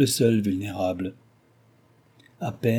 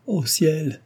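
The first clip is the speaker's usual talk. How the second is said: higher, dismayed exclamation; overcome with shame; 6 semitones higher than usual. A narrator's reading. Cécile cuts Delville short, overcome with shame. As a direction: cry out in mild, mortified dismay. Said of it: "accablée de honte".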